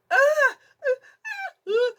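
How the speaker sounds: repeated loud, high shrieks; piercing panicky yelps; re-enacted screaming. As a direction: scream in bursts of mock panic. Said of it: "I'm just screaming like".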